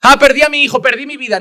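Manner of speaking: loud, anguished outcry of a bereaved parent; grieving cry. Acted. Preacher voicing a grieving parent, loud anguished cry. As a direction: cry it out like someone who just lost a son.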